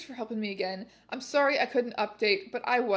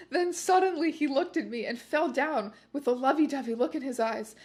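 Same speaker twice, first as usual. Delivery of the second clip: usual speaking voice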